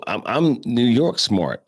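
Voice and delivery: normal talking voice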